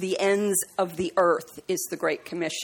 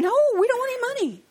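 higher and fast, pushing back harder; emphatic retold refusal with "dont" stressed; insistent refusal; faster than usual. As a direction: refuse the money firmly and quickly. Refusing again, more insistent.

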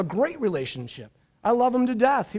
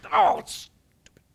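high, hissed whisper of annoyance; frustrated whisper; 14 semitones higher than usual. Retold or imitated. Pastor reliving his fight with the paper cutter, whispered. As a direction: mutter it under your breath, fed up.

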